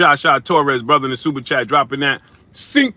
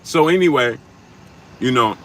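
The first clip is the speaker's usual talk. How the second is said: loud; 4 dB louder than usual. Real, not acted. Loud topic change.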